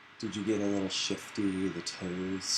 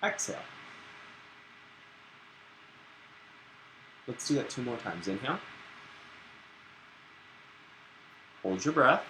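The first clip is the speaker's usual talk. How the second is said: guided breathing; 2 semitones higher, 6 dB quieter than usual. Acted. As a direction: lead the class through each breath.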